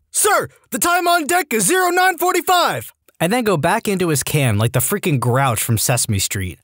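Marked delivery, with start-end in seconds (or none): 0.1-2.9 very high-pitched acted shout; hammed-up, squeaky military yell; mock recruit yell. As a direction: sound off like a recruit reporting to a drill instructor. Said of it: "pop out with his watch and yell".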